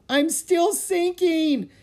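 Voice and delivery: very high, loud, drawn-out wail; panicked wail. A narrator's reading. Annie sinking in mud calls to Jack, wailing. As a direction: wail slowly in rising panic. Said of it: "Annie wailed".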